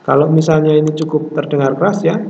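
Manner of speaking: ordinary talk, nothing marked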